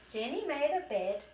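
plain reading voice

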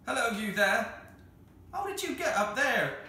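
husky, strained call back; reply huskily, voice worn from howling. Acted. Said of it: "managed to reply huskily".

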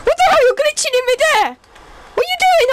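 high startled yelp of disbelief; sudden high-pitched what-the-hell; surprised. Real, not acted. Player shocked at friend glitching, yelping.

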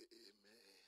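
low, very soft, unexcited response; muted amen. Retold or imitated. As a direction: imitate a congregation's lukewarm amen. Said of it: "people say".

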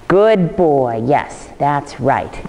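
reward him gently with your voice; praising the dog. Shown as a demonstration. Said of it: "So it will be like this".

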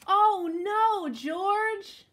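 loud, high cry of dismay; exasperated cry. Acted. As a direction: call out the dog's name in mock despair. Exasperated cry at dog's mischief.

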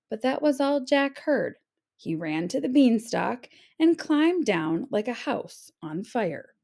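usual speaking voice

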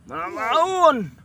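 surprised shout. Real, not acted. Sudden cry at the eel.

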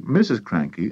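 usual speaking voice

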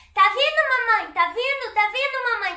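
high, loud meme imitation. Youtuber imitating a viral meme to viewers, high and loud. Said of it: "Vocês já viram esse, esse meme?".

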